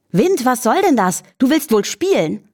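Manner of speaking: hoch, verwundert und neugierig; erstaunt. Acted. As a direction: staunend fragen, Stimme höher. Lilli spricht zum Wind. (verwundert und neugierig fragend)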